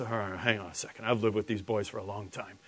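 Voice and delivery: low, very soft aside; hushed, low-pitched wary old father; a little tentative. Acted. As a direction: murmur doubtfully to yourself. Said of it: "was a little tentative at first".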